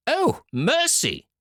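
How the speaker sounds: very high, drawn-out shriek of dismay; mother shocked by vipers and toads; horrified cry. Acted. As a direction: recoil in horror. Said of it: "cried the mother".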